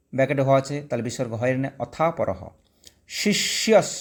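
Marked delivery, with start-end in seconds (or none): 3.2-3.9 শিষ্যস with the final স held dental; dental s ending. Shown as a demonstration. Teacher showing students the dental স in শিষ্যস. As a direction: end শিষ্যস on a clear dental s. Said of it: "দন্ত সহিত দন্ত স পর্যন্ত যাবে".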